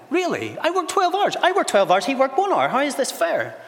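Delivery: high-pitched, outraged protest; indignant outburst. Acted. Workers who did twelve hours complain about pay, indignant. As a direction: protest the injustice, voice shooting up high.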